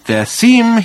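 high, over-articulated explanation; slow, distinct, patient. A narrator's reading. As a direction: spell it out for someone dim. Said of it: "slowly and distinctly and patiently".

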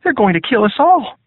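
high, panicked soldier voice; terrified. A narrator's reading. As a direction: voice jumps high with fear. Hugh voiced, terrified captive.

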